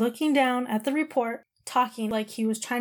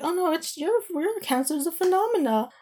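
imitating the doctor's offhand tone, pitched higher; mock-casual surgeon brushing it off, voiced higher; aloof doctor; 7 semitones higher than usual. Surgeon telling patient her cancer is a phenomena, imitated with aloof higher voice. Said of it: "like, so aloof".

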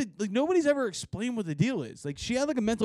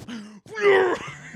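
high gagging laugh; 13 semitones higher, 5 dB louder than usual. Retold or imitated. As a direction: gag and laugh at once.

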